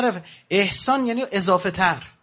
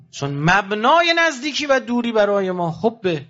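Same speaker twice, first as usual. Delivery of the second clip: usual speaking voice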